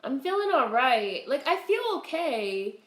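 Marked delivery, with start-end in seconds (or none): none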